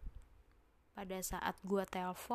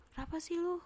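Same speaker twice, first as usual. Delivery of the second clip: sharp, much higher startled ask; alarmed question; 10 semitones higher, faster than usual. Retold or imitated. Narrator startled, asking his friend what is wrong. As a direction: snap the question out in sudden alarm.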